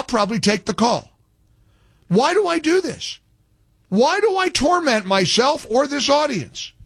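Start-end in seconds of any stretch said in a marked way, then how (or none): 2.1-6.7 much higher pitched, theatrical despair; exasperated. Real, not acted. Radio host lamenting taking the call, high exasperated wail. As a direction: groan it out at yourself.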